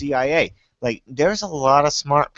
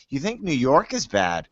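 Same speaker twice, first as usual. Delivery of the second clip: ordinary delivery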